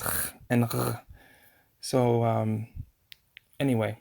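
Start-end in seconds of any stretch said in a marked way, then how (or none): 0.0-1.0 "ch" and "r" both scraped at the back of the throat; guttural sounds. Shown as a demonstration. Teacher summing up modern Hebrew, guttural. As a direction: rasp "ch" and "r" as bare throat sounds. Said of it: "So everything is".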